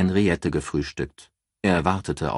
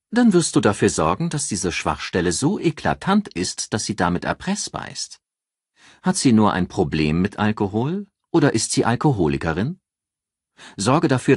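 higher, louder assured female voice; raised voice for a calculating friend giving advice; Ann, cool strategist; 3 semitones higher, 3 dB louder than usual. Acted. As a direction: lay out the plan like a cool strategist. Ann coldly advising.

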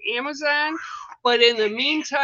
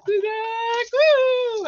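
excited squeal; 11 semitones higher, much slower than usual. Real, not acted. Host squeals to viewers over her new deck, high. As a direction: squeal it much higher, then whoop.

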